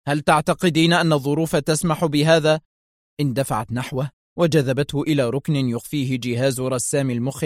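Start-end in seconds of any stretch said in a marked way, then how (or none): none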